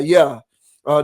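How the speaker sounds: usual speaking voice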